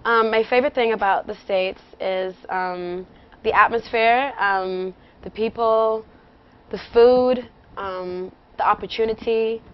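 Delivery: put-on American accent, measured delivery. Shown as a demonstration. Singer answering a fan's question, best American accent. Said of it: "I'll answer it in American accent".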